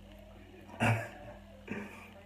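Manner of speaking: real, voiceless laugh; breathy laugh. Real, not acted. Streamer amused by his own bad Creole, breathy laugh. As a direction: laugh at your own attempt.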